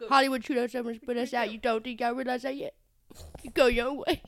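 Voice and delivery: quick imitated small town American accent, mother; fast, a touch of a small town accent; small town accent, rushed. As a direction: rattle off the small town mom's line. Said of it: "this amazing small town accent".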